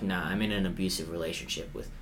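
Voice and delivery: wry defeated fan; deadpan self-mockery. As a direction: confess it like a hopeless case, quietly. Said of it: "And I was like".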